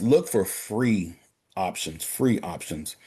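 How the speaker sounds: slow down and hammer "free options", then ease off; stress on free. Real, not acted. Banker telling the guys to seek free help, slow stress. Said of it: "I like to emphasize the word free".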